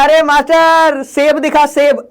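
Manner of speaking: छात्रों की नकल. Retold or imitated. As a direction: call out slowly like a teasing pupil. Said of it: "सेब बोलते हो ना तुम लोग". (छात्र की खिंची, ऊँची नकली आवाज़)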